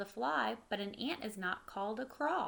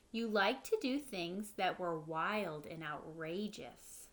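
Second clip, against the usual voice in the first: usual speaking voice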